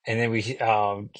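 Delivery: ordinary talk, nothing marked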